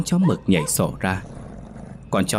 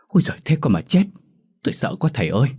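high, alarmed exclamation, easing slightly; startled panic; 7 semitones higher, much faster than usual. A narrator's reading. Frightened man learning a demon is in his house, panicked outburst. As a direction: cry out in fright to the teacher. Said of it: "Ông Tốt thảng thốt".

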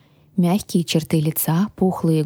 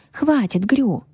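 higher-pitched, mildly irritated grumble; grumbled; 6 semitones higher, slower than usual. A narrator's reading. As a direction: cut the dragon off with a grumble. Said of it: "буркнула я". (недовольно буркнуть)

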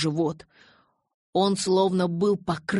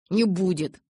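буркнул; 2 semitones higher, 4 dB louder than usual. A narrator's reading. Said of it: "буркнул я".

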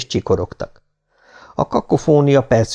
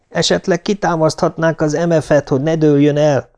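ordinary delivery, nothing marked

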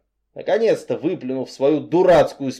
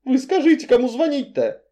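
put-on pleading girl voice; 11 semitones higher than usual. Frightened heroine pleading.

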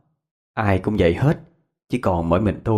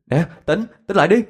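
panicked plea to wake up; urgent rousing; 10 semitones higher than usual. Acted. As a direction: shake someone awake with your voice. Reviving a fainted friend.

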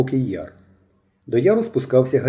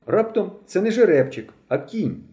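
ordinary delivery

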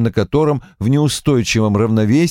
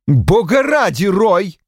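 loud; 10 semitones higher, slower than usual. A narrator's reading. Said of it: "громко сказала Ким".

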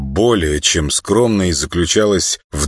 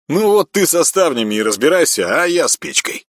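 brash retort; 10 semitones higher than usual. Acted. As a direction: toss it back cheekily. Ряба answers brashly. (дерзко)

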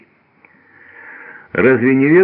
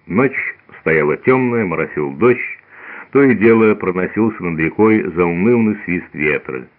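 plain reading voice

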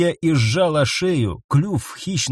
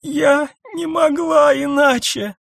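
sobbing; 12 semitones higher, slower than usual. A narrator's reading. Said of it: "всхлипнула Ниса".

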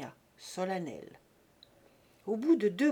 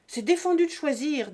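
high, loud angry objection; raised voice, full of rage; furious protest; 6 semitones higher, 9 dB louder than usual. A narrator's reading. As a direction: burst out crossly at a cheat. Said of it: "dit rageusement Buteau".

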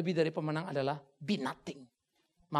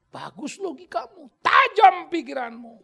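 rising emphasis; 12 semitones higher, 17 dB louder than usual. Real, not acted. Rising emphatic sermon point.

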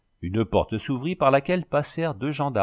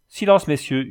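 "Silence, messieurs" yelled, higher and louder, falling hard; usher yelling; 6 semitones higher, 6 dB louder than usual. A narrator's reading. Usher yelling at the laughing crowd for silence, loud. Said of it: "hurlait l'huissier".

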